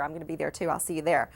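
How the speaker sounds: normal talking voice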